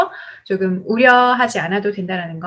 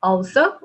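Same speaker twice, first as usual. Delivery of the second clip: Also lifted at the end; rising intonation. Shown as a demonstration. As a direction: raise Also as an opener. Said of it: "올라갑니다". (Also 끝 억양을 올려서)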